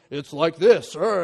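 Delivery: loud put-on grunting husband voice; mock husband grunt. Wife imitating her husband with a grunt. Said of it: "what does her husband sound like".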